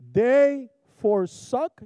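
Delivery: forsook said very slowly, each syllable drawn out; careful pronunciation. Shown as a demonstration. Preacher unsure of a word, slow careful pronunciation. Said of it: "Is that how you pronounce that".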